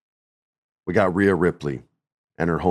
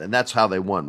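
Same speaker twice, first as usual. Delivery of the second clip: normal talking voice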